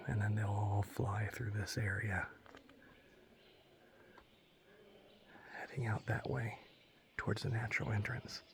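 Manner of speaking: soft unvoiced whisper. Real, not acted. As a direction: whisper quietly to the viewers. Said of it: "I have to whisper".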